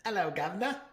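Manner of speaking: high, soft mock British "Hello, Governor"; British accent again. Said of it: "I was trying".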